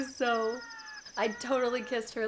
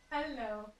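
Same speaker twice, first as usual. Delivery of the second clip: fond pet talk; 12 dB quieter, slower than usual. Real, not acted. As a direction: coo at a needy cat. Talking fondly to her cat.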